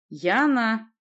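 insist, refusing to budge; stubborn insistence. A narrator's reading. Sorceress insists she is the niece, stubborn. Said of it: "упрямо повторила колдунья".

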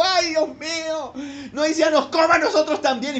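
mock panic as the earth swallows them; panicked cry. Fleeing Israelite crying out, mock terror.

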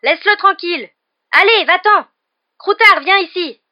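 very high, loud frantic cries; shouting. A narrator's reading. Ron chasing after his pet, shouting. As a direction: yell it across the lawn. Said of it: "ses cris se répercutaient en écho".

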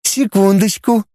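high, slow sing-song robot voice; cartoon princess. A narrator's reading. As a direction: sing it out sweetly, drawn out. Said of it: "пропела роботесса".

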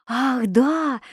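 a touch of tearful feeling; mildly moved, wistful agreement. A narrator's reading. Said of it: "отвечала я со слезами". (растроганно, чуть со слезами)

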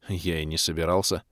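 usual speaking voice

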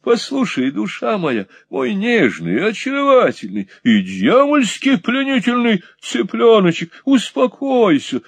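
high, coaxing plea; high meek wheedling to an angry woman; meek pleading. Acted. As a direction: soothe her, sweetly pleading. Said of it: "смиренно сказал мистер Монталини".

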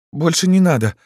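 put-on high-pitched girlish request; shy hurt girl. A narrator's reading. Embarrassed Lyuska asks Yegor to stop, shy. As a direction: ask gently in a high voice.

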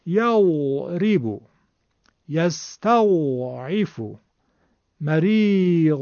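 slow "یستضعف" with a drawn-out cooing Dad; original Hijazi Dad. Shown as a demonstration. Teacher demonstrating the original Dad, drawn-out moan. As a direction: stretch the Dad in "یضرب" and "مریض" without any d stop. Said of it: "این صدای زاد اصیل هست".

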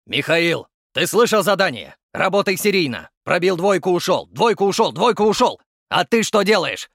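barking gym coach. A narrator's reading. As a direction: bark the drills at the boxer. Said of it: "заорал тренер".

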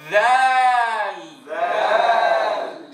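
each ذال stretched long and loud; drawn-out letter name. Shown as a demonstration. Teacher shows students the tongue while saying ذال, long and loud. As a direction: model ذال slowly, tongue visible. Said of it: "شوفوا اللسان".

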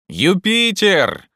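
long, high, loud call; shouted name. A narrator's reading. Legrand ignores friend and summons Jupiter, shouting. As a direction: holler the name up the tree. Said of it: "крикнул Легран".

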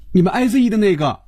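high, quick, huffy reminder. A narrator's reading. Phoebe prompting a clueless Hongjun, huffy and annoyed. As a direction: snap it out fast and high. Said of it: "便气呼呼地提醒了一句".

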